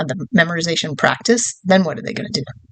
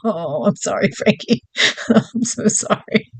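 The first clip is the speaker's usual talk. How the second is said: laughing apology; slower than usual. Real, not acted. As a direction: apologize through giggles. Laughing apology to another participant.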